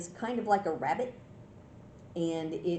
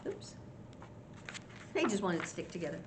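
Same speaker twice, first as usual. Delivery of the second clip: usual speaking voice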